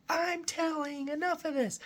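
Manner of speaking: very high squeaky creature voice; high-pitched rat protest; fed-up wererat. Acted. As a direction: squeal it as a beaten rat about to flee. Voices nearly dead wererat giving up.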